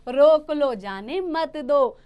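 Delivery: loud "रोक लो", then slow, firm "जाने मत दो"; emphatic paraphrase, broken after रोक लो; pause after रोक लो. Shown as a demonstration. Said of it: "वही साइन यहाँ पे रोको के बाद आया".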